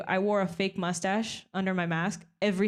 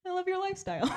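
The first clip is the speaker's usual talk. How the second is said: high, gushing voice of a stranger; mimicked gushing woman; 12 semitones higher, faster than usual. Comic imitating a gushing stranger's compliment in a high voice. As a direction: play the starstruck stranger pitched way up.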